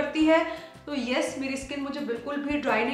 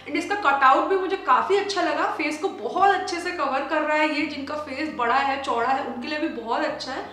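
face covered by mask; talking with mask on; through a sheet mask. Real, not acted.

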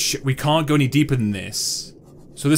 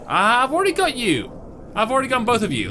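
high exclamation; 7 semitones higher than usual. Real, not acted. Recognises items already collected.

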